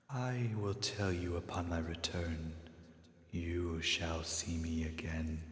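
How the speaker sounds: put-on hushed and weary, like something old from the earth; slow whispered otherworldly voice; ancient spirit voice. Toll answers.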